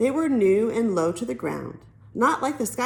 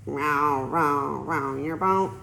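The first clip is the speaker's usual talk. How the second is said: mock nasal twang; 6 semitones lower, much slower than usual. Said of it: "in his little nasal twang".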